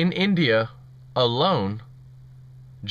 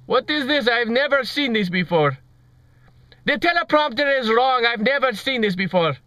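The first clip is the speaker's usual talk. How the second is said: high-pitched mock Indian accent of a flustered newsreader; hammed-up Indian-accented anchor losing the script; badly faked Indian accent, rattled on air; Karmish, Indian version; 5 semitones higher than usual. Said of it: "That was an Indian version of it".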